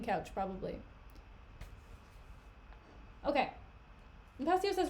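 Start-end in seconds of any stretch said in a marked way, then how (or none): none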